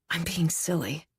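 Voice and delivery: calming herself after the scare, quietly; softly to herself. Acted. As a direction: talk yourself down under your breath. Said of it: "I tell myself".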